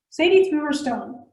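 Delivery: usual speaking voice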